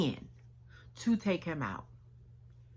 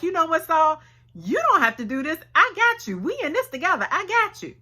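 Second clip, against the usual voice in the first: higher, pumped-up loyal friend; excited sidekick egging him on; eager buddy; 10 semitones higher, faster than usual. Retold or imitated. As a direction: hype him up, you've got his back. Abishai eagerly urging David.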